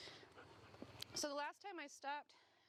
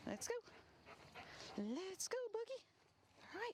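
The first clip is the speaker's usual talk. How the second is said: upbeat dog call; 10 semitones higher than usual. Real, not acted. Trainer calling the dog Boogie to come along, high and upbeat. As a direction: chirp it to get her moving.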